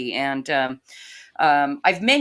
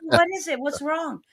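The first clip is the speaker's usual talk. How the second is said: alarmed mom; 10 semitones higher than usual. Retold or imitated. Mother alarmed at crying daughter.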